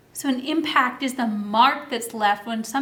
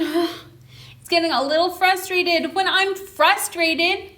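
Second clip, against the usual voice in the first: usual speaking voice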